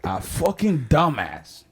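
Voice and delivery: scornful rant. Real, not acted. Host berating an athlete abroad, scornful. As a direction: lay into them with open scorn.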